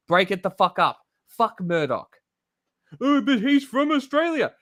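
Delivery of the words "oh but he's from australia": mock whiny objection. Mocking an imagined objection.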